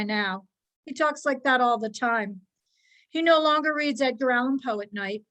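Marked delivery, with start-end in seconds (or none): none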